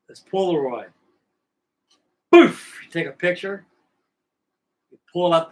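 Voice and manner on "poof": sudden loud high poof like a flash going off; mock camera flash noise. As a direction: pop it out, much higher and louder. Imitating the Polaroid flash.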